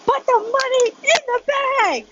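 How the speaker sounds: very high, drawn-out cartoon holdup cry; SpongeBob-episode robber. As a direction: squeal the demand slowly in a cartoon voice. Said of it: "like, that SpongeBob episode".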